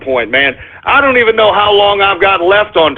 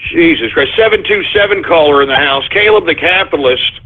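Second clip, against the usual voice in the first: ordinary delivery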